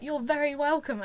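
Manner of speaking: loud, high, emphatic courtesy; hearty reply. Retold or imitated. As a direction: imitate a star graciously answering a fan. Star's hearty reply, acted.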